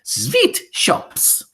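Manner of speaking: high, breathy triumphant cry; Grand High Witch shouting out her plan. Acted. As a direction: fling the answer out like a prize. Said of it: "shouted the Grand High Witch".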